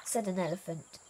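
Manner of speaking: ordinary delivery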